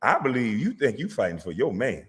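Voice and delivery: usual speaking voice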